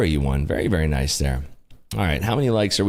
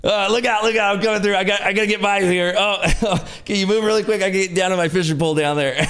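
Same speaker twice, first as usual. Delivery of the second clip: higher, hurried mock pushy passenger; lightly voiced rude boater shoving through; pushy fisherman voice; 9 semitones higher, faster than usual. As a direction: barge past everyone, half-joking. Acting pushy guy on raft.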